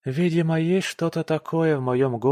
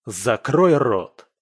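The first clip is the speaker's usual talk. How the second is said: slow, loud bark; husband roaring at wife; booming order; 7 dB louder, much slower than usual. Acted. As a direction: roar it at her. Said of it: "Мой голос громыхает".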